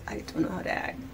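whispered repeat. Shown as a demonstration. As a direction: breathe it as a whisper.

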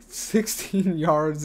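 breaking into a laugh mid-stat, slow; can't hold back a laugh reading the number; real laugh slipping into the yardage. Real, not acted. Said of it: "I'm trying not to laugh when I read that".

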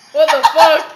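loud, slow, laughing what the fuck; laughing disbelief. Real, not acted. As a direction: crack up in disbelief. Reacting to the gross drink.

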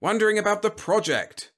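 high, loud, irritated snap; snapping. A narrator's reading. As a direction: snap it impatiently. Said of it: "snapped the General".

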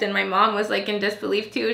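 ordinary talk, nothing marked

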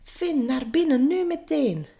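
high urgent shouted order; urgent call. A narrator's reading. Knights calling Finn inside, urgent call. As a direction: call the boy indoors at once, high. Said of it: "Dan riepen alle ridders".

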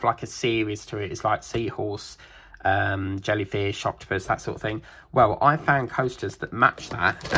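normal talking voice

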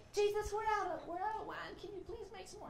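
high, fading mock plea; pleading guests. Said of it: "they're like".

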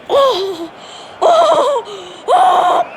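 laughing shouts. Real, not acted. Gamer reacting to a wild play, laughing shouts climbing.

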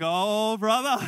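loud, drawn-out "oh, brother"; exclaimed reaction. Retold or imitated. Preacher retelling his reaction to his seatmate's question, exclaimed.

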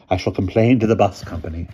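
loud, indignant headmistress; fuming. A narrator's reading. Headmistress threatens the bus company, fuming. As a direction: huff it out with indignation. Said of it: "fumed Miss Scrimmage".

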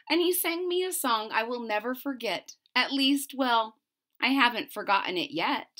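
lively storybook read-aloud; bouncy picture-book reading voice; reading to children. Acted. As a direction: bring the rhymes to life for little listeners.

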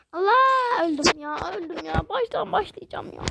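high loud cry, then whining; shrieked dismay. Real, not acted. Gamer dying again, shrieking in dismay. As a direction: shriek then complain like you've lost again. Dies in game, cries out.

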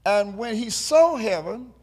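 stretched, sing-song preaching; building sermon. Acted. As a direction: lift the congregation toward the point. Preaching climax.

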